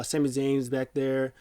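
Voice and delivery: usual speaking voice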